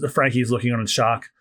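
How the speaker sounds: usual speaking voice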